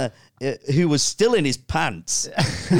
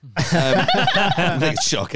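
slow laughing; 3 semitones higher, much slower than usual. Real, not acted. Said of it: "I'm shaking a lot".

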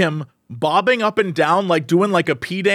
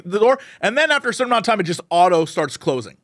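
ordinary delivery

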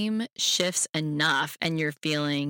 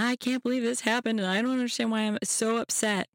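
higher-pitched, upset pouring-out to a friend; lightly acted tearful complaint, pitched up; mock tearful venting; 6 semitones higher than usual. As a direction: act out crying to a friend. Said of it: "and you're crying".

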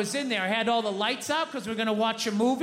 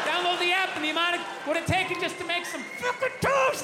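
high, shouted disbelief; exasperated rant; 8 semitones higher than usual. Real, not acted. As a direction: rail against the app. Comedian ranting about toast app.

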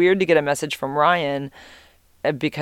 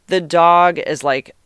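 ordinary delivery

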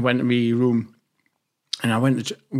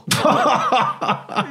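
burst of laughter; 11 semitones higher than usual. Real, not acted. Laughs at the question about death threats.

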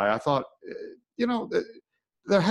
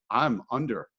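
normal talking voice

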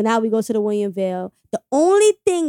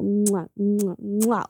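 exaggerated smacked "mwah" kisses; kissing noises; much slower than usual. Woman re-enacting her delight at the ring, kissing noises. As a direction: blow big audible kisses, one after another.

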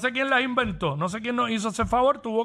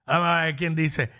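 normal talking voice

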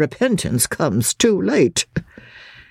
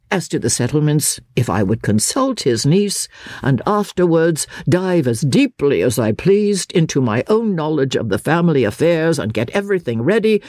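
usual speaking voice

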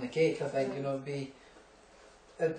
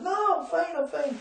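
much higher, squeaky denial; mock protest; 13 semitones higher than usual. A raised-pitch friend insisting to the medics he's fine. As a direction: whine it like a cornered man.

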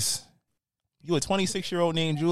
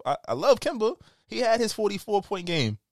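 ordinary talk

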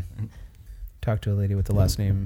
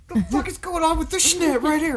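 squeaky high joke voice; silly high voice. Pretend. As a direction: pitch way up for a gag.

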